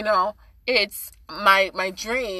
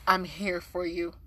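soft, supportive murmur; gentle reassurance; 2 semitones lower, 8 dB quieter than usual. Acted. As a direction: offer quiet comfort to someone hurting. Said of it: "Like, listening".

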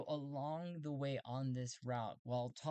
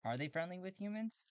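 normal talking voice

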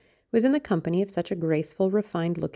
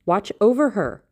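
louder, higher emphatic plea; raised voice, pitched up and urgent; urgent cry; 4 semitones higher, 6 dB louder than usual. A narrator's reading. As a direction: cry it out as an insistent order. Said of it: "he cried".

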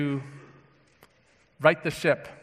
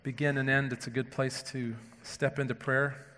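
hushed, low, unhurried prayer; low, reverent address to God; 4 semitones lower, 8 dB quieter than usual. Real, not acted. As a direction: pray softly with long pauses between phrases. Leads the congregation in prayer.

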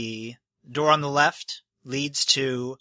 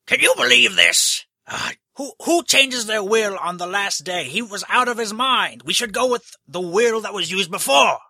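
much higher and louder, outraged; indignant nobleman; 7 semitones higher, 5 dB louder than usual. Acted. Papa Gold Hat outraged at the changed will, much higher and louder. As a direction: play him as a pompous, offended rich man. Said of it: "And he says".

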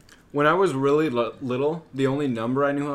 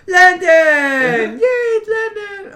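put-on high, loud delighted kids cheering; excited kids; 9 dB louder, much slower than usual. Cousins at a family gathering cheering for Landon. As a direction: squeal like happy little cousins. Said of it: "they'll be all".

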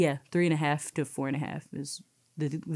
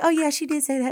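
put-on little girl's voice; 9 semitones higher, faster than usual. Voicing the little girl conceding.

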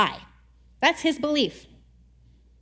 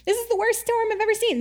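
much higher, bewildered and frightened; panicked sailors; 10 semitones higher than usual. Retold or imitated. Poor sailors caught in storm, high mock panic. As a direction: squeak out the sailors' confusion. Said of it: "they're just like".

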